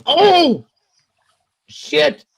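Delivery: very high, loud yelp of shock; shocked exclamation. Retold or imitated. Dad turns and sees son's swollen head, shocked yelp. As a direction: re-enact a startled yelp, drawn out then cursing.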